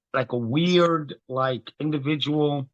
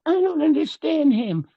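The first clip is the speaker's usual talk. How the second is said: mocking confused listener; 11 semitones higher, 4 dB louder than usual. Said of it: "They go".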